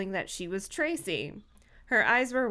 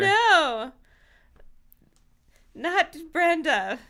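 high, loud cry of disbelief; horrified cry; 7 semitones higher, 7 dB louder, slower than usual. Acted. Reader voices a horrified onlooker, horrified cry. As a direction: wail it out in storybook horror.

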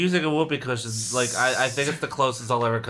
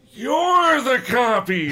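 slow, high, louder accusation; accusing; 10 semitones higher, 3 dB louder, much slower than usual. Acted. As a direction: throw the charge back.

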